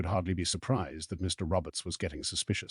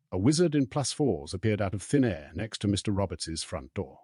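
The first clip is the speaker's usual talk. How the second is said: ordinary delivery, nothing marked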